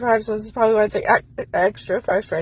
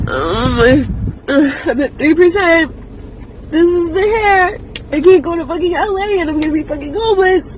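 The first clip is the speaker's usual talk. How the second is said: high, loud crying words; sobbing breakdown; 9 semitones higher, 7 dB louder than usual. Real, not acted. Breaking down in the car over money, sobbing. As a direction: fall apart in sobs.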